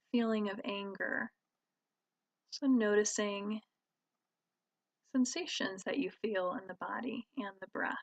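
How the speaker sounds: calm, even voice with long pauses; guided meditation. Real, not acted. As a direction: guide listeners gently inward, leaving space between phrases. Leads a meditation.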